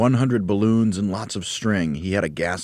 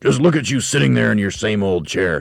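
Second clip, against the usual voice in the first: raised, louder exclamation; crying out; 5 semitones higher, 7 dB louder than usual. A narrator's reading. Husband pointing out wife in her chair, loud cry. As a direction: call it out in mock amazement. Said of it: "he cried".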